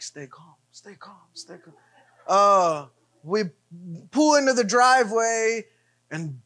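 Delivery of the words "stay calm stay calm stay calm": very soft, low retold self-talk; calm yourself down under your breath; hushed self-calming.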